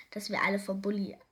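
usual speaking voice

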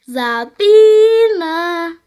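loud, high, drawn-out call of a name. A narrator's reading. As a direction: shout it across the square, stretching the vowels. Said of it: "erschallte eine Stimme am anderen Ende". (lautes, langgezogenes Rufen)

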